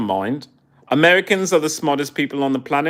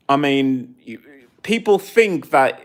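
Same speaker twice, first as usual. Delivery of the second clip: ordinary talk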